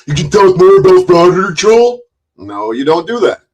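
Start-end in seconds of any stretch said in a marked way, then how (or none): 0.1-2.0 higher, louder mock chat voice; high, loud mock voice of a chat viewer; mocking chatter. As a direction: mimic a fan gushing in chat. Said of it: "show up in his chat and go".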